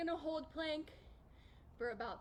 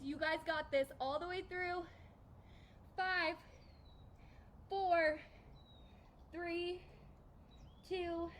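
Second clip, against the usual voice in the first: usual speaking voice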